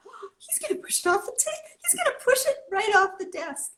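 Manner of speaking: high, rushed alarm at the book sliding; panicked thought. Retold or imitated. Telling the host her panic as the owner pushes her book, high alarmed thought. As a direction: race up in pitch, sure it will fall. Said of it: "and I thought".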